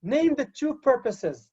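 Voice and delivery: "name the two purposes" with a falling command tune; command intonation. Shown as a demonstration. Teacher models a command's intonation for the class. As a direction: give "name the two purposes" as an order, pitch dropping at the end. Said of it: "Listen to my in".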